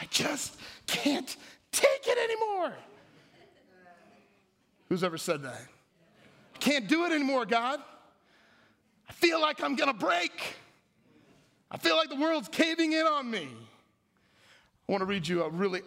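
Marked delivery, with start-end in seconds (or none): 0.0-2.7 slow, high, drawn-out cry; hammed-up breaking point, soaring in pitch; desperate outburst. Acting out someone worn down by little things, desperate wail. 6.6-13.5 high, acted cry for help; desperate plea. Someone overwhelmed crying out to God, acted desperation.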